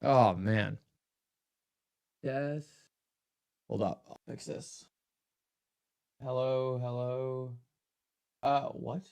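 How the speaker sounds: mic glitch, otherworldly tone; alien voice. Real, not acted. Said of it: "Sounds like I'm an alien".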